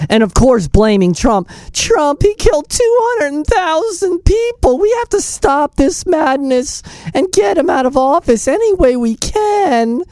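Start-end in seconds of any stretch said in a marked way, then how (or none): 1.7-10.0 much higher, mock-tearful accusation; mock crying. Host mocking Kamala tearfully blaming Trump, much higher. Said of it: "She's, like, crying".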